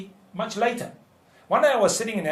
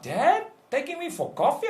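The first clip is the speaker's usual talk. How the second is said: lightly voiced excited daughter, higher pitch; delighted daughter; 9 semitones higher than usual. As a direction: imitate a pleasantly surprised daughter. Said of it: "Her eyes lit up".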